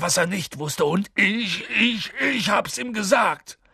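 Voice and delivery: high, tearful acted wail; distraught big man crying in remorse; sobbing. A narrator's reading. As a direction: blame yourself bitterly through tears. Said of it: "schluchzte er".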